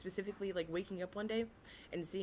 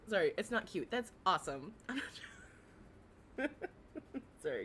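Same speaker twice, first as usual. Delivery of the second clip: ordinary talk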